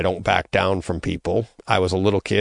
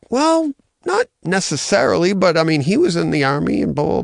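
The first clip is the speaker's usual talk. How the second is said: put-on offhand teen self; 9 semitones higher than usual. Teen self answering mom.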